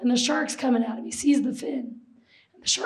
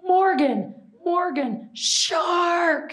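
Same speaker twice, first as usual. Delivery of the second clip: imitating crew yelling a shark warning; retold screaming; 5 semitones higher, much slower than usual. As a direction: call out the name as a retold alarm. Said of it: "everybody behind him was screaming".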